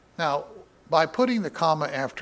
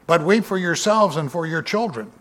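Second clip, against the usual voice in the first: raised, declaiming Bible reading voice; lifted pulpit reading, a touch louder; scripture reading; 5 semitones higher, 5 dB louder than usual. Acted. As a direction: proclaim the verse to the congregation. Reading Jesus' words aloud.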